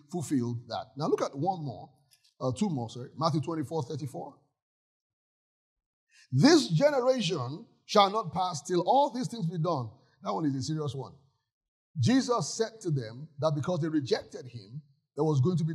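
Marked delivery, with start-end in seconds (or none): 6.3-9.9 louder, slow solemn recitation; solemn slow scripture read. Acted. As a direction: intone it like holy writ. Quoting scripture aloud.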